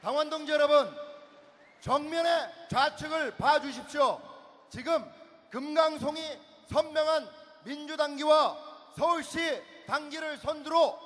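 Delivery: rousing emcee announcement; event announcer. Acted. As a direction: announce the entrance to the crowd. MC announces leaders' entrance.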